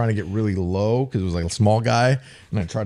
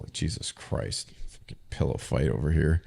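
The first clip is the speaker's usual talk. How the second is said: muttered mock scold; 6 semitones lower, 8 dB quieter than usual. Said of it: "he just twirls whistle".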